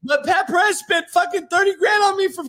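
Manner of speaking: fake-bawl it like a spoiled kid; mock crying. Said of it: "I'm crying".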